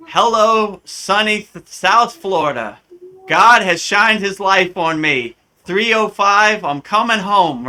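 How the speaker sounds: high, loud, gleeful homecoming cry; joyful cheer. Retold or imitated. Telling his guru about Miami, happy loud shout. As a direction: celebrate like you just escaped winter.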